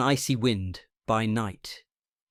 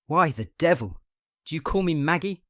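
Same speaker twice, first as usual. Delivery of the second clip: sharp, higher, louder snap; vicious snap; 6 semitones higher, 5 dB louder than usual. A narrator's reading. Patient snaps at friend calling him Maggie, a vicious snap. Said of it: "snapped the other viciously".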